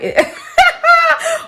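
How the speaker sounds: high-pitched burst of laughter. Real, not acted. Storyteller breaks off at being interrupted, high laugh.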